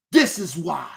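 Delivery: usual speaking voice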